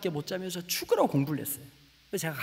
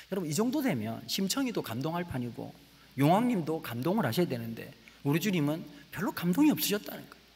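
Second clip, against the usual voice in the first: normal talking voice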